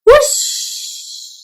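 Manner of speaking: ordinary talk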